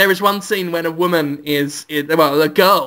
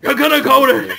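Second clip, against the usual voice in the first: shouting hoo-ha, rough and higher; Al Pacino impression; 6 semitones higher, 3 dB louder than usual. Teller attempts Al Pacino's hoo-ha, hammed up. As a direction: bark it like a bad Pacino. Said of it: "I can't do him at all".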